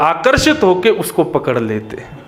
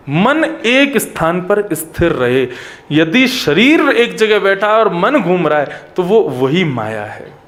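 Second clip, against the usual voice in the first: usual speaking voice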